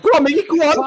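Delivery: high, loud urging on; excited urging. Retold or imitated. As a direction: urge him on, thrilled. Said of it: "I thought".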